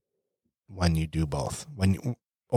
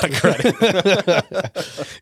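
laughing; 9 semitones higher, much slower than usual. Real, not acted. Teasing about the wife listening.

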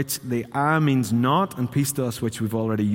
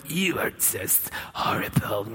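slow, hissing devil whisper; menacing unvoiced whisper, drawn out; villainous enemy; 3 semitones lower, 5 dB quieter, slower than usual. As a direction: whisper it like an obvious, hammed-up villain. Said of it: "if he came and went".

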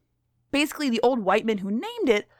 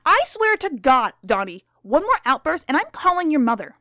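loud, fed-up scolding teacher; angry teacher; 4 semitones higher, 5 dB louder than usual. Acted. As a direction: scold the pupil, losing patience.

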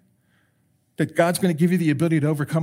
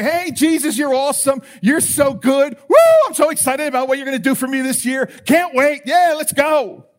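mock hyped-up prayer, higher and louder; pretend-thrilled believer faking it for Jesus, hammed up; fake excitement; 6 semitones higher, 5 dB louder than usual. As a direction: ham up a phony cheerful praise. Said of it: "Don't put this fake face on and say".

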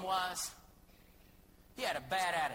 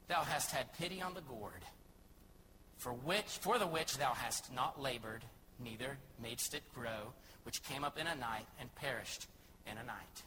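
the Lord speaking; 7 semitones lower, 5 dB quieter than usual. Acted. The Lord gently rebuking Jonah over the gourd, low and soft. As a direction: lower and softer divine voice. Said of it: "Then said the Lord".

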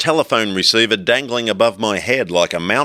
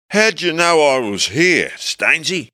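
higher, lazy hippie tone; 7 semitones higher than usual. A narrator's reading. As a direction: stay in the dazed hippie. Hippie voice continues.

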